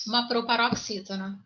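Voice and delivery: ordinary delivery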